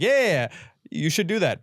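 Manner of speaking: high-pitched mock encouragement; insincere egging-on. Friend goading someone who won't really do it, mock encouraging. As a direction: egg someone on without meaning it. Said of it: "they don't think you're gonna do it".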